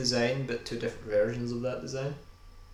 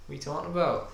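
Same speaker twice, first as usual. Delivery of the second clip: the incredulous repeat, pushed up in pitch and volume; 6 semitones higher, much faster than usual. Acted. Louder repeat of same line.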